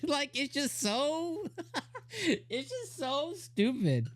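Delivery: high, slow words through helpless laughter; laughing too hard to talk; real cracking up. Real, not acted. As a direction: barely get it out for laughing. Cracking up at the show.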